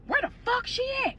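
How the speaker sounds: high, distant furious shouting; angry yelling. Retold or imitated. Mother storms in looking for her, angry yell. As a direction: yell it from down the hall.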